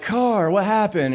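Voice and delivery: stunned by the change; high amazed question; astonished. Pretend. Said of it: "And I'll be like".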